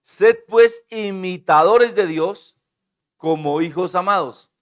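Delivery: loud, slow reading; proclaimed scripture. Real, not acted. Preacher reading Ephesians to the church, loud and slow. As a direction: declaim the verse to the church.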